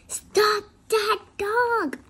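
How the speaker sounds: high, loud and slow shout. Acted. King shouting at the crowd to stop the dog. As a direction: yell after the runaway dog. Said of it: "shouted the king".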